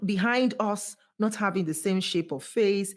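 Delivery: ordinary talk, nothing marked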